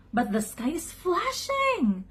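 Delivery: high, excited duck call; high-pitched astonished announcement; alarmed report. A narrator's reading. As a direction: call the news down, rising high. Duck calls down alarming news.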